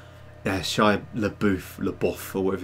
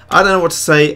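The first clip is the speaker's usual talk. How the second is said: lightly voiced character, higher and louder; put-on viewer voice; 6 semitones higher, 9 dB louder, faster than usual. Streamer reading a viewer's comment aloud, in a put-on voice. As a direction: lift it up into a character voice. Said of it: "I do voices for different people".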